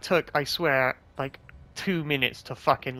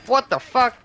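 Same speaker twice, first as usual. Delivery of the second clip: louder, higher angry yell at the game; furious shout; 9 semitones higher, 9 dB louder than usual. Real, not acted. Gamer yelling at the game after missing, furious shout. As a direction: blow up over a missed shot.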